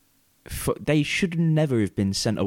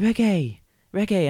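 imitating the girls; 11 semitones higher than usual. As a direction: chirp it up like the picky girls. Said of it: "they was like".

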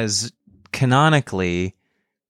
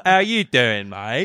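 matey mock-Cockney greeting; hammed-up Cockney; Cockney Superman. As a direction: do a Cockney superhero saying hello. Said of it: "He's cockney as hell".

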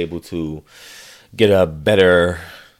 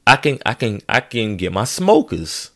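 usual speaking voice

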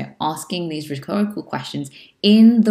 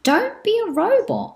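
much higher, playful "don't be a robot"; playful warning. Shown as a demonstration. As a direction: tease the listener out of sounding robotic. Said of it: "speak it in a monotone way".

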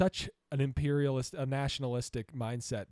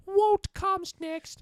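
high, slow, hammed-up fake British accent; mock a bad stage British accent; over-the-top fake British accent; 5 dB louder, much slower than usual. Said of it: "really fake British accent".

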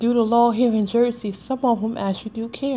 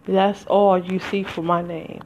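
usual speaking voice

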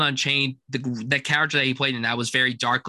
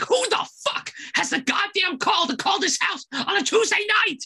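a much higher, angry bellow at the phone; furious shout. Retold or imitated. Father yelling about a phone call at night, furious shout. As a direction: roar it like an enraged dad. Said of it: "and he's like".

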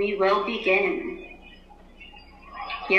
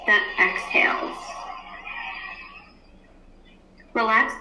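guided meditation. Acted. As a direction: ease the class into stillness. Teacher leads class meditation.

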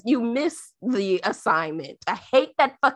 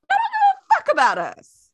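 much higher, fast, louder outcry; venting at billionaires, high and fast; outraged peak; 4 dB louder, faster than usual. Real, not acted. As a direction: push the pitch way up in fury. Ranting at billionaires.

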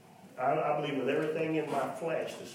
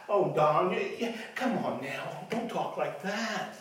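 mock scoffer; 5 semitones higher than usual. Mimicking dismissive listener.

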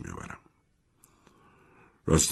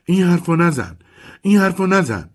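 much higher, louder frantic shout; 13 semitones higher, 7 dB louder than usual. A narrator's reading. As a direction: yell it over and over, grabbing his coat. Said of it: "داد زد".

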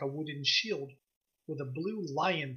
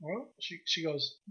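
normal talking voice

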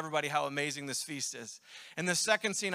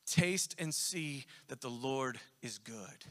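slow, hushed and gentle, lower; tender voice of God; 5 semitones lower, 7 dB quieter, much slower than usual. Retold or imitated. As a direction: speak it like a loving invitation. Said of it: "He says".

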